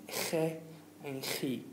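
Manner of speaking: "ge and chi" as kheh and khee; "ge and chi" with a Spanish jota sound; throaty G. Shown as a demonstration. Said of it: "from your throat for those two".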